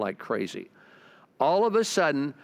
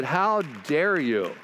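slow, drawn-out acted reproach; indignant rebuke; slower than usual. God rebuking the preacher once more, slow and indignant. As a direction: stretch out a scolding "dare".

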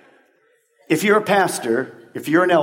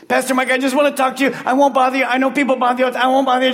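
put-on anxious young man; 7 semitones higher, faster than usual. Young man approaching pastor in line.

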